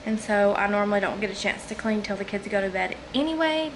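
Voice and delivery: ordinary talk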